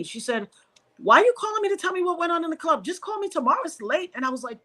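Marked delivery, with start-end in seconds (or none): 1.0-4.1 quick, higher-pitched phone scolding; impatient, raised-pitch nagging; irritated sister. Retold or imitated. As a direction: imitate a sister brushing off a late call.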